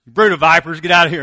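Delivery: loud, furious yell at churchgoers; mock angry shout. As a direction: shout it like throwing people out of church. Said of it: "Somebody comes to church".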